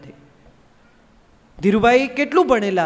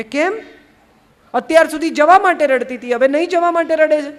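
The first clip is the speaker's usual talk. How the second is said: higher and louder, baffled; can't believe she's crying now; bewildered husband, voice raised; exasperated surprise; 6 semitones higher, 6 dB louder than usual. Acted. Kabir puzzled by her crying.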